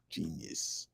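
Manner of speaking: ordinary delivery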